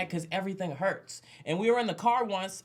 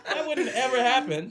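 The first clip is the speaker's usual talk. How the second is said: high laughing voice; 7 semitones higher, 5 dB louder than usual. Real, not acted. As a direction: crack up while saying it. Laughing hard.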